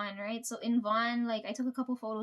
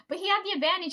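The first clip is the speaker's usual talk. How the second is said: higher, eager protest; excited protest; 6 semitones higher, 3 dB louder, faster than usual. Retold or imitated. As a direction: argue the point eagerly. Teller defends her point to Snack.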